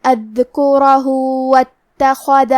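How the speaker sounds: اذکرہ واتخذ very slow, ہ stretched two counts into واتخذ; joined recitation. Shown as a demonstration. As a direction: chant اذکرہ واتخذ slowly with the short madd. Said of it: "دو مد کے برابر لمبی".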